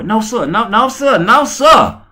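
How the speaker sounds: put-on high, pleading little boy; scared kid voice. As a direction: squeak out a frightened no sir. Voicing scared son.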